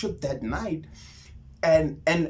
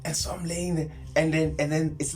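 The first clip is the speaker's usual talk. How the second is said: ordinary talk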